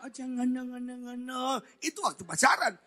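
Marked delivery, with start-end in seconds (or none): 0.0-1.6 high, soft coy protest; Sinta. Retold or imitated. Girlfriend demurs sweetly while dating, coy. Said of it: "Ceweknya kayak Sinta".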